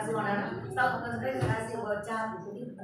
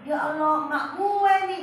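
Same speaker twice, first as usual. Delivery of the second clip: high, loud happy cry of recognition; delighted recognition; 6 semitones higher, 8 dB louder than usual. Retold or imitated. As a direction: greet her like long-lost family. (seru gembira mengenali)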